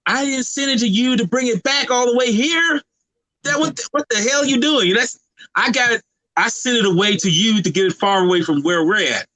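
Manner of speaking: high-pitched, incredulous telling-off; exasperated retold scolding. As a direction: act out indignant disbelief, pitched well up.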